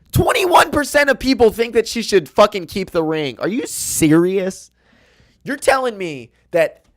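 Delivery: outraged at the result; indignant shout at the poll; outraged disbelief. Real, not acted.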